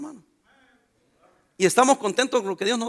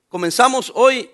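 usual speaking voice

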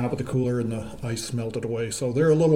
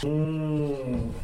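distant, softer from across the room; far from mic; 5 dB quieter than usual. Real, not acted. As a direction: speak away from the microphone. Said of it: "I'm gonna step away from the mic".

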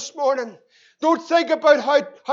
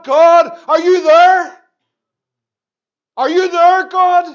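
desperate cry to God; 2 semitones higher, 5 dB louder than usual. Acted. Psalmist crying out to God.

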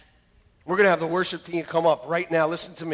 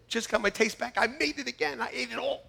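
normal talking voice

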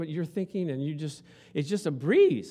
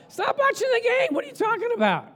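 mock doctor; 4 dB louder than usual. Doctor quoted, mock exasperation.

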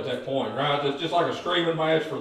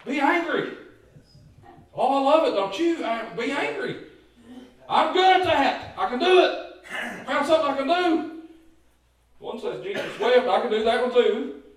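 joyful preaching; 8 semitones higher than usual. Acted. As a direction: revel in the verse like good news. Preacher jokes about anger.

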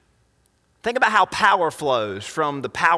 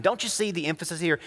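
ordinary delivery

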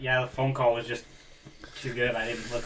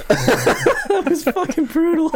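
laughing; 9 dB louder, much slower than usual. Real, not acted. Laughing at brutal joke.

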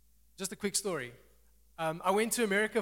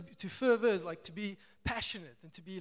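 ordinary delivery

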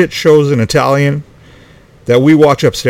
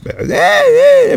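high mock whine of a kid griping; whiny kid bitching. Dad imitating son whining to friends, mock whine.

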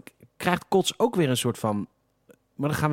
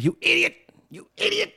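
high-pitched mock shout of idiot; squeaky hammed-up angry yell. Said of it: "die zegt".

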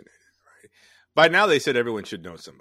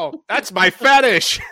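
high, loud, slow clown shout; silly clown; 11 semitones higher, 10 dB louder, slower than usual. Pretend. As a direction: yell it like a hammy clown. Clown bit continues, shouting.

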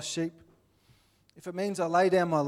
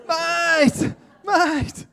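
shouted, high-pitched Aussie "mate"; exaggerated Aussie; 13 semitones higher, 9 dB louder than usual. Aussie spots a countryman overseas, shouting in broad Australian accent. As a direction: bellow it like an overjoyed Australian. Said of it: "you go back to Aussie".

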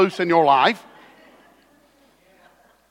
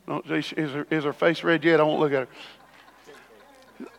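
slow, soft aside to the room; hushed teasing aside; quiet aside; 7 semitones lower, 7 dB quieter than usual. Real, not acted. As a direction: drop the voice as if she can't hear. Aside about daughter in congregation.

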